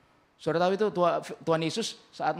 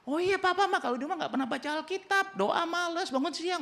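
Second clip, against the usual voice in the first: much higher, imitating a blurting kid; child voice. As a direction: play the tattling child spilling family secrets. Child tattles on father.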